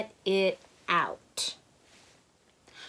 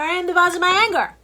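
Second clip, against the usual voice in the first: much higher, louder, fast affirmation shout; loud climax; 8 semitones higher, 6 dB louder, much faster than usual. Shown as a demonstration. Teacher finishing the mantra for kids, loud and high. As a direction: end the chant with a bold declaration. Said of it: "Say out loud ten times".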